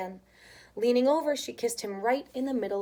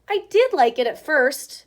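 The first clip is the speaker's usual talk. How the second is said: put-on louder child admission; Chester admitting; 4 semitones higher, 10 dB louder than usual. Chester admits to his mother, louder child voice. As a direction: own up in a raised child voice. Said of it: "admitted Chester".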